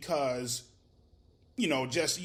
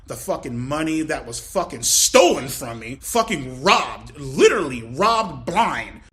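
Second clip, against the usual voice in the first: loud, heavily punched venting; aggrieved outburst; 7 dB louder than usual. Real, not acted. Venting that he was robbed.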